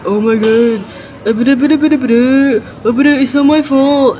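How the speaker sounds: mocking Tiffany's whiny excuse. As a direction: stretch it out in a whiny put-on voice. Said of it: "Tiffany was like".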